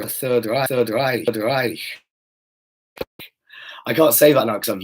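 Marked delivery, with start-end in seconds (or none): 0.1-1.8 "Reich" said with a German accent. Shown as a demonstration. Speaker trying out German words mid-rant, German accent. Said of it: "I always do German words in a German accent".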